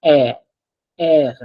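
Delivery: A and AIR with long open eh; open E sound. Shown as a demonstration. Teacher repeats the rule sound, long open eh. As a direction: draw out a, then air, with open eh. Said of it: "it's pronounced as a".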